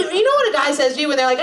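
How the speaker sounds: ordinary delivery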